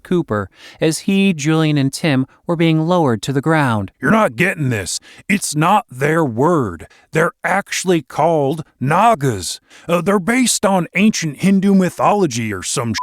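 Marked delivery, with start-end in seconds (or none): 4.0-13.0 a hint of an exasperated gamer buddy; lightly voiced know-it-all friend. A narrator's reading. Friend correcting the others about the creatures, lightly exasperated. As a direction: explain it like it's obvious. Cooper's voice.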